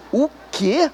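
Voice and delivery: soft, strange-voiced confused question; quiet bewildered aside; baffled inner what. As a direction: mutter it to yourself, baffled.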